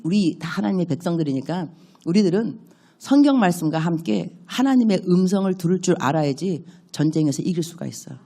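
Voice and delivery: ordinary delivery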